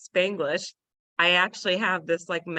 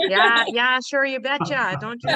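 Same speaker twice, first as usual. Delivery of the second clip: higher, laughing Minnesota "yeah, yeah, sure, you betcha"; Minnesota accent; 4 semitones higher, 6 dB louder than usual. Shown as a demonstration. Showing friends her Minnesota accent, higher and laughing. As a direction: ham up a Minnesotan's cheery agreement. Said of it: "I seriously sound like a Minnesotan".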